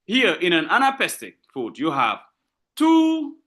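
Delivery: usual speaking voice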